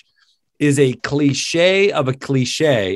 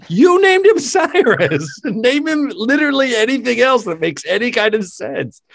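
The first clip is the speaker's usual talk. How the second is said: incredulous mock rant; 9 semitones higher than usual. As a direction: throw it back at them, high and laughing. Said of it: "I'm like".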